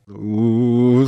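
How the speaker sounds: long sustained wordless "oo"; acted tearless wail; low dreadful moan. A narrator's reading. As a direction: moan on one held note. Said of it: "сказала Соня всё тем же низким страшным звуком".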